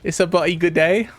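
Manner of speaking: over-the-top falsetto British accent; high British accent. Shown as a demonstration. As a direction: squeak it out like a cartoon Brit. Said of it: "Just less deep".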